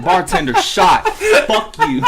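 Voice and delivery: very high, loud bar shout through laughter; hammed-up drunken-bar yelling; mock yelling. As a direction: holler it across the room as a joke. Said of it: "Just yelling".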